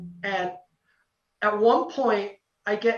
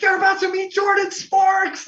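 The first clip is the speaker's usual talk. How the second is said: high, loud mock fan squeal; excited squealing; 11 semitones higher, 6 dB louder than usual. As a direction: imitate friends freaking out, voice shooting way up. Friends' excited reaction, re-enacted.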